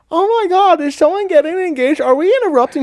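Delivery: very high, loud squeal of excited onlookers; gushing girls. Retold or imitated. Onlookers interrupting a park proposal, high mock gushing. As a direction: mimic girls gushing over someone's engagement.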